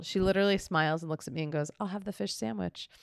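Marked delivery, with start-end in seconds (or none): 1.8-2.9 softly retold passive-aggressive order. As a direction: order it quietly, with a pointed edge. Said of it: "So passive aggressive".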